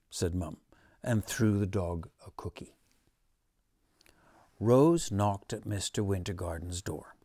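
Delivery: ordinary delivery, nothing marked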